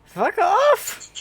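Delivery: high, loud yell with the voice cracking; loud cracked outburst; cracking shout. Acted. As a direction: snap it out so the voice breaks. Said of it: "your voice cracked".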